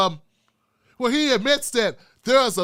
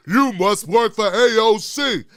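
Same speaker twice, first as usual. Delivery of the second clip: mock accuser; 4 dB louder, slower than usual. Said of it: "they say".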